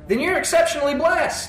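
exultant preaching. Acted. Preaching climax.

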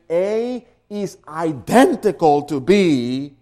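"identical" punched loud; loud, insistent weight on "identical"; stress on IDENTICAL. Shown as a demonstration. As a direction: hit "identical" hard. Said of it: "now you're making a real emphasis".

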